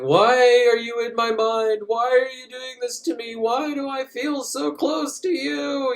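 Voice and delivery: high, pleading mock questions, slowing at the end; whiny hero. Hero pleads with the alien woman, high mock whine. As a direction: ham up a needy lovesick plea. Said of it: "he's sitting there going".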